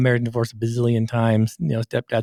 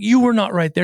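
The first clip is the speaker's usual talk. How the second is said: retold yelling; 8 semitones higher than usual. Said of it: "And I start yelling at him".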